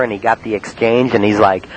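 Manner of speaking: normal talking voice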